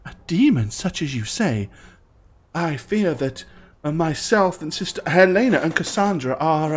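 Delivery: put-on a nervous young temple sister; young priestess voice.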